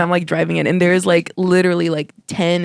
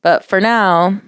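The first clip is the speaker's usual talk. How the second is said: podcast outro read; 3 semitones higher than usual. Acted. Podcast sign-off.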